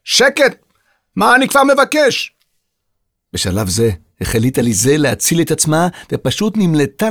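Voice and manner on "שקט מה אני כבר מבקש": high, exasperated yell; irate, put-upon outburst; shouting. A narrator's reading. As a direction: demand silence at a shout. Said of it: "צעק".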